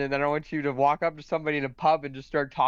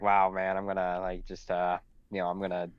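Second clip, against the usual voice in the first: mocked starry-eyed dreamer; 7 semitones lower than usual. Co-host mocking people with big voice acting plans, low dreamer impression. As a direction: mimic a dreamer rambling on, pitched well down. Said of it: "don't be like one of those people like".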